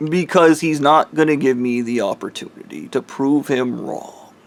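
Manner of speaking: ordinary delivery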